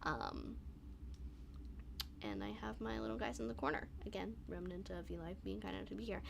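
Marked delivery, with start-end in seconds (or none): none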